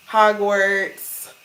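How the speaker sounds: ordinary delivery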